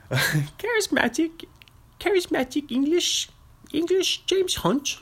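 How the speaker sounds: very high, squeaky mock Cockney; Cockney accent. Shown as a demonstration. Host parodying a description of James Hunt, high mock Cockney. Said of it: "I was about to do a Cockney".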